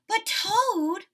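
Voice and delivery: high and loud plea; crying out. A narrator's reading. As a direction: call out to your friend. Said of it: "cried Frog".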